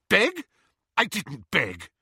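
high, loud indignant denial. A narrator's reading. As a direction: burst out in outraged denial. Petunia turned scarlet, denies begging.

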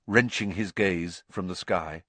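usual speaking voice

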